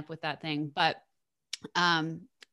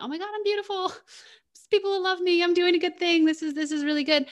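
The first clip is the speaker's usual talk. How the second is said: much higher, airy giddy self-praise; higher, faster mock princess gushing; 11 semitones higher, 4 dB louder, faster than usual. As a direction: play a vain princess delighted with herself. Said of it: "you get the princess of".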